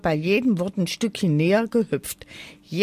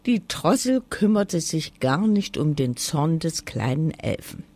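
usual speaking voice

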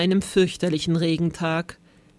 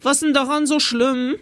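cheeky schoolboy question, pitched up; higher, pert kid's challenge; 6 semitones higher, 3 dB louder than usual. A narrator's reading. Cheeky Fred from the back row asks the teacher, pert. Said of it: "fragte der freche Fred".

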